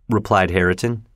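plain reading voice

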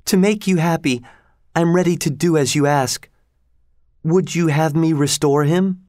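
high-pitched, eager-to-please queen; obliging sorceress. A narrator's reading. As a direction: offer obedience to a beloved, pitched high. Voices the sorceress.

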